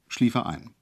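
usual speaking voice

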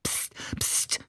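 soft, voiceless shushing; hissed hush. A narrator's reading. Ali warning the others about the windows, hissed hush. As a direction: hiss for silence under your breath. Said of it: "zischte Ali".

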